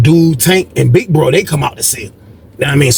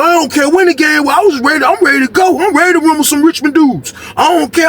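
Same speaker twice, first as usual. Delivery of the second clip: high-pitched eager boast; pitched-up ready to go; fired-up cellmate; 11 semitones higher than usual. Retold or imitated. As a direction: talk like you're itching to fight. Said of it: "He was like".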